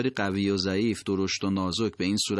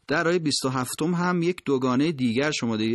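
usual speaking voice

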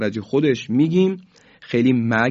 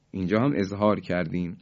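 usual speaking voice

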